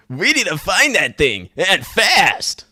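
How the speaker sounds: high put-on cartoon voice; urgent game character. Game character rallying the others, hammed-up urgency.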